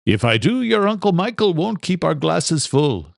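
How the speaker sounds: lightly voiced Irish father with a joke; Irish brogue, cheeky comeback; a hint of an Irish father joshing; Irish father, joking. Father's voice in story.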